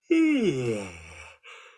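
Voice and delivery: loud yawn tailing off; a waking-up yawn; morning yawn. Shown as a demonstration. Coach acting out a waking-up yawn, loud. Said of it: "you're like".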